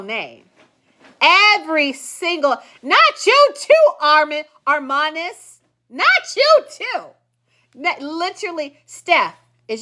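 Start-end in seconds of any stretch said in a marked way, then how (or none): 2.8-3.9 high, slow, drawn-out dismay; mock betrayal. Real, not acted. Teasing a commenter who mispronounced too, high slow dismay. 5.9-7.0 mock betrayal. Real, not acted.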